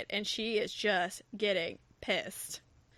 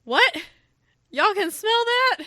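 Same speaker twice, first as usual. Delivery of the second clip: higher and louder, mortified shriek; shocked squeal; 9 semitones higher, 8 dB louder than usual. Retold or imitated. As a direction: squeak it out in horror. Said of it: "she's just like".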